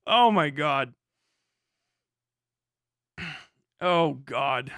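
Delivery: ordinary talk, nothing marked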